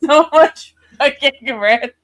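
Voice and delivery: high, laughing through words. Real, not acted. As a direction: giggle while speaking. Laughing excitedly.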